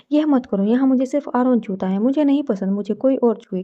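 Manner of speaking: usual speaking voice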